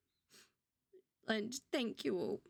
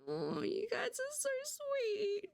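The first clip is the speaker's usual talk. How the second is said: tearful; 12 semitones higher, slower than usual. Real, not acted. Streamer thanking followers, voice cracking into tears. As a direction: voice breaking high with real tears, slow. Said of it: "you're making me emotional".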